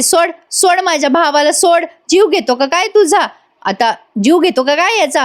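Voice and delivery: high-pitched mock yell without volume; retold yelling. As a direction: imitate an aunt pleading to let go. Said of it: "ती आत्या पण आहे तिथे आरडाओरडा करते". (आरडाओरड्याची सौम्य, उंच स्वरातली नक्कल)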